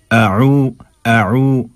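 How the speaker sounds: "अऊ" with a hamza, then a throaty Arabic ain; careful Arabic "अऊ". Shown as a demonstration. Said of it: "हमज़ा आइन".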